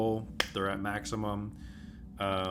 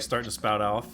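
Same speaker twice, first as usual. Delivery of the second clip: ordinary talk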